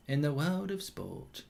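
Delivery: hushed, slow voiceover, sinking near a whisper; very soft, drawn-out solemn commentary; attempted impression. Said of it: "I can't do it".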